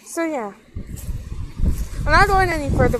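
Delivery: ordinary talk, nothing marked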